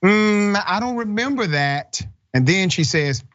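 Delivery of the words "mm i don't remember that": high-pitched mock teacher voice; put-on high voice, playing dumb; imitating the teacher. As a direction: mimic her feigned forgetfulness, pitched well up. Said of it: "the teacher initially says".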